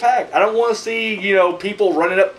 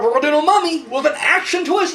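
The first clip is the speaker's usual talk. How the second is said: higher-pitched mimicry of studio hype; mock studio pitch; 8 semitones higher than usual. Imitating studio bosses announcing a remake, mock excited pitch.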